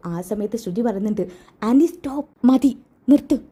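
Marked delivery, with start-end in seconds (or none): 1.6-3.4 higher, louder cry to halt; urgent protest. Acted. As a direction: cut in forcefully to stop a slap. Daughter halts aunt about to slap. (തടഞ്ഞുകൊണ്ടുള്ള ഉറച്ച ശബ്ദം)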